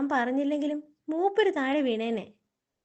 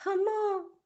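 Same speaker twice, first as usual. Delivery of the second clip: high, startled yelp of fear; a scared little wail; frightened cry; 6 semitones higher, much slower than usual. Acted. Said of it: "താനെന്തിനാ പേടിച്ച് കരയുന്നത്".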